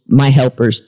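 ordinary delivery

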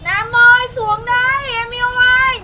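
put-on loud, high calling out to a boy upstairs; shouting up to him. Girl calling up to Nam's house, loud shouting. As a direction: yell it up at the window, lovesick.

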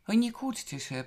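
high-pitched impatient rebuke. A narrator's reading. Alicja stops the others quarrelling, impatient. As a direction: cut the squabble short impatiently. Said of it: "powiedziała niecierpliwie Alicja".

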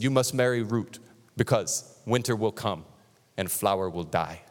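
lightly imitated African accent, low and solemn. African friend warning the speaker about marriage, in a lightly imitated solemn African accent. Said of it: "this is what he said to me".

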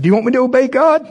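high, loud, fast anxious question; seeking her approval; high rushed plea; nervous asking. Retold or imitated. Nervous asking lady permission.

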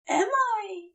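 a dazed angel asking himself; dazed wondering. A narrator's reading. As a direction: drift up into a confused question. Aziraphale's dazed inner voice.

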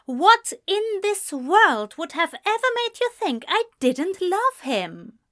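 high-pitched, incredulous indignation; affronted Ezra. A narrator's reading. Man challenged on his love by friend, indignant. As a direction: demand an answer, high and emphatic.

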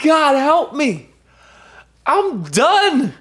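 high, slow, worn-out plea to God; desperate cry for help. Retold or imitated. A worn-out believer begging God for help, high and slow. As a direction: call out high and drawn out, spent. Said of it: "just be like".

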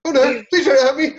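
very high, laughing mock terror; panicked frantic pleading. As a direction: squeal it like a cowering coward. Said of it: "he's like".

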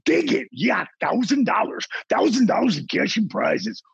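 high, hyped nightclub pitch man; hyped promo voice. Retold or imitated. Plugging his nightclub's Wednesday night, in a hyped high promo voice. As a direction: sell Wednesday night like a radio DJ hyping cash and prizes. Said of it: "I might go".

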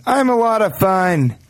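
high, slow voice for a mustache; be a silly mustache announcing itself; talking mustache. Pretend. Guest voicing what the mustache tells people, high silly voice. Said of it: "this mustache says".

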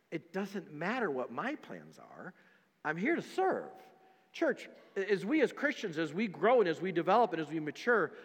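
normal talking voice

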